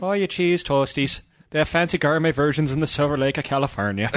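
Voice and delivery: lilting mock Irish storyteller; Irish accent. Comedian riffing on cheese toasties in a mock Irish accent. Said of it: "Joe does a better Irish accent".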